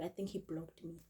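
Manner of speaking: usual speaking voice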